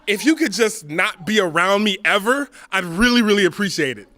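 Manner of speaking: high, loud, laughing cruelty; cheerfully cruel. Retold or imitated. Comic telling his girlfriend he hates her, gleefully cruel. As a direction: deliver insults with a grin.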